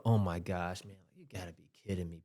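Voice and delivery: sighing, dropping low and soft, rushing the end; exasperated sigh. Retold or imitated. Retelling his sigh at a ridiculous movie moment, exasperated mutter. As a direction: mutter it under a defeated sigh. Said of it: "I let out a sigh".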